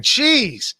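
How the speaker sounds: normal talking voice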